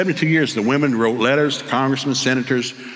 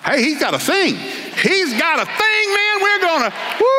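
very high, overjoyed whooping delivery; hammed-up excitement; slower than usual. New father seeing his son born, hammed-up excitement. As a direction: relive the thrill, voice shooting way up. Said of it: "I got real excited".

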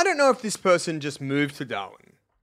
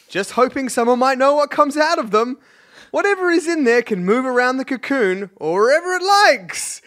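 raised voice, reading it aloud; reading a post aloud; 7 semitones higher, 4 dB louder than usual. Retold or imitated. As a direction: read it like the nervous poster.

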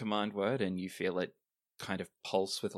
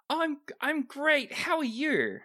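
high, drawn-out chirpy reply; chipper; 5 dB louder, slower than usual. Acted. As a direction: answer high and slow, chipper as can be. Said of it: "Their voice is very chipper".

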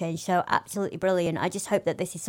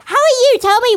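excited greeting; 14 semitones higher, 10 dB louder, faster than usual. Real, not acted. Bursting greeting to co-host.

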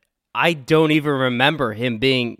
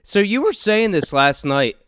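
cracking mid-sentence; 6 semitones higher than usual. Real, not acted. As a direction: voice breaking up. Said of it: "Ugh, my voice cr-, keeps cracking".

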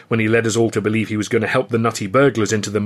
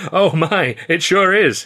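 higher and louder through a laugh; 7 semitones higher, 4 dB louder, slower than usual. Acted. Babe howling at Stan's joke, laughing. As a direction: crack up as you say it. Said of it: "he laughed".

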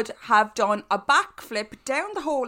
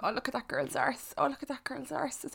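much softer, higher admiring murmur; envious murmur; 4 semitones higher, 11 dB quieter than usual. Retold or imitated. Watching booty videos, soft envious murmur. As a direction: hush it like a secret envy. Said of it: "being like".

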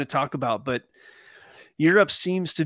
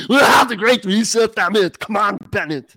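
put-on higher, loud laughing Austrian-accented bodybuilder; loud, higher Arnold voice with an Austrian accent; Arnold impression; 7 semitones higher, 9 dB louder than usual. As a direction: ham up a big Austrian accent. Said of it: "You think I'm Arnold?".

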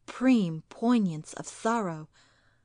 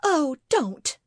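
high, louder cry of protest; distressed cry; 7 semitones higher, 5 dB louder than usual. Acted. Wife begs husband to stop, crying out. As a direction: cry out, begging him to stop. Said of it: "she cried".

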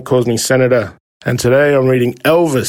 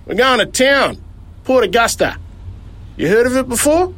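higher character voice, grudging and bossy; gruff driver; 10 semitones higher than usual. Acted. Driver grudgingly lets the boy in, stern. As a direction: give in reluctantly, then lay down the rule.

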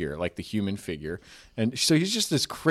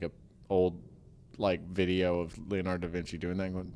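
ordinary talk, nothing marked

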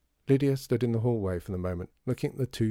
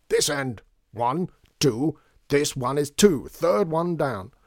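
louder, higher, counting out directions; impatient calling; 5 semitones higher, 5 dB louder, slower than usual. A narrator's reading. Shopkeeper directing child through hatch, calling out. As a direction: call it slowly to someone out of sight.

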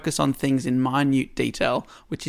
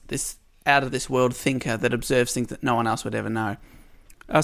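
ordinary talk, nothing marked

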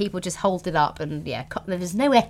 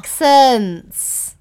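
jingle shout; 5 semitones higher, 11 dB louder than usual. Pretend. Trying out a jingle.

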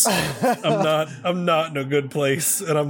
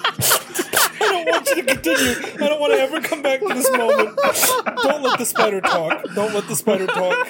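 high, half-laughing, half-crying panic; 9 semitones higher than usual. Real, not acted. Player dreading the spider's voice, laughing panic. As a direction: beg through helpless, squealing laughter. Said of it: "might actually be having a small panic attack".

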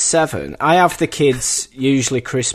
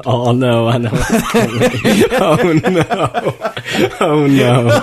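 laughing oh no; 4 semitones higher, 6 dB louder than usual. Real, not acted. Laughing sympathy at story.

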